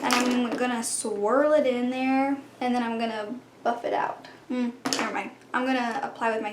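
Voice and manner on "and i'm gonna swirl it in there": usual speaking voice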